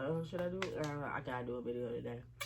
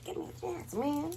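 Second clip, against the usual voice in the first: begging; 12 semitones higher, 5 dB louder than usual. Real, not acted. Final plea, loud high end.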